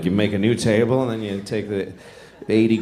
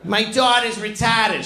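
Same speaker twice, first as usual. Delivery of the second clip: high, slow Boston-accented imitation; Boston teacher; 10 semitones higher, slower than usual. Teacher telling her class about her daughter, high Boston voice. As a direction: announce it slowly and high in broad Boston. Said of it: "She used to tell us every day".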